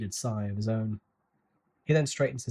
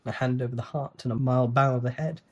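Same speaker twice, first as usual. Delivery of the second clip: usual speaking voice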